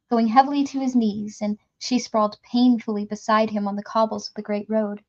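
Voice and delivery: usual speaking voice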